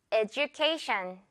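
"education" said eh-joo-KAY-shun, with a j sound, slowly; right j in education. Shown as a demonstration. Teacher showing students the native "education", j sound. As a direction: say "education" with a soft j. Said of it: "edu 주의를 하면서".

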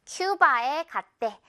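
"큐바" inside Korean said KYOO-ba, slowly; slow down on "큐바" with an English "kyoo"; English pronunciation. Shown as a demonstration. Teacher puts English pronunciation into Korean for practice, "큐바" as KYOO-ba. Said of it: "한국말 자체를 선생님이 좀 영어 발음으로 해줄게요".

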